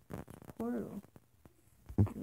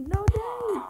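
high, loud dramatic shout; dramatic cry; 12 semitones higher, 8 dB louder than usual. Acted. Friend stopping Dave in the rescue, dramatic cry. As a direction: cry out in hammed-up alarm.

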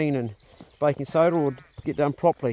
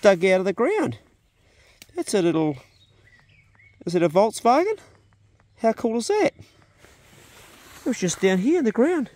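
much higher, thrilled voice; excited discovery; 8 semitones higher than usual. Real, not acted. Farmer digs up old toy car, thrilled. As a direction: sound delighted at the find.

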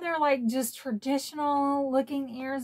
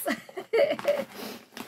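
laughing cry; 13 semitones higher than usual. Real, not acted. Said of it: "such a crybaby".

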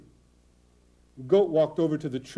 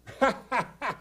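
a knowing, put-on chuckle; goat's fake laugh; 7 semitones higher than usual. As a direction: laugh as if at a good joke. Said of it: "He started to laugh".

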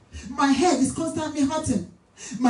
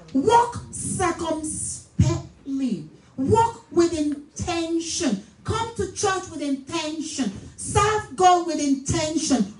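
raised-pitch, drawn-out exhortation; emphatic preaching; 5 semitones higher, slower than usual. Real, not acted. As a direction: drive each phrase home to the congregation. Exhorts the congregation.

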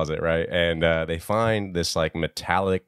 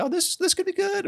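mock hopeful. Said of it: "I thought would be like".

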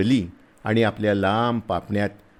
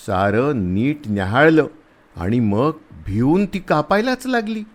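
ordinary delivery

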